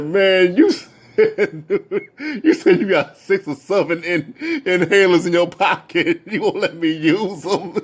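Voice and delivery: talking through high giggles; imitated laughing fit. Rapper repeating the inhaler joke, helplessly laughing. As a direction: mimic him barely getting words out. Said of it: "He kept laughing like".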